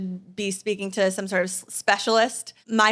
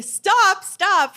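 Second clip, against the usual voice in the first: sharp high shouts of stop; yelling stop; 11 semitones higher, 5 dB louder than usual. Retold or imitated. Husband yelling from bed to stop her crawling, loud high shout. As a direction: shout it to snap someone out of a nightmare. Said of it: "Michael yelling at me".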